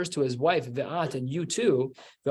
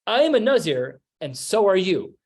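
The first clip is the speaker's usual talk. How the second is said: higher and louder, telling not asking; insistent declaration; 5 semitones higher, 6 dB louder than usual. Retold or imitated. Husband telling his wife she's a Nazir too, insistent. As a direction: announce it as a done deal. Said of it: "He's telling her".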